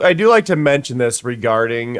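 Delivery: ordinary delivery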